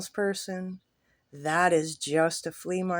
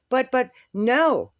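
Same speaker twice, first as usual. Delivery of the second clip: flustered protest; 6 semitones higher, 8 dB louder, slower than usual. Retold or imitated. Old man protesting.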